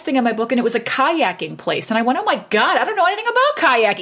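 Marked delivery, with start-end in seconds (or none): 2.2-4.0 much higher, fast panicked gush; retold panic. New rep sees a kayaking listing, fast high panic. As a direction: blurt it out in rising panic. Panics seeing next business listed.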